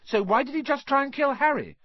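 high, loud challenge; crying out in protest. A narrator's reading. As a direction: cry it out, indignant and high. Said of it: "cried Hermione".

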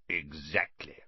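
a nasty, low bark of a word. A narrator's reading. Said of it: "said Uncle Vernon nastily".

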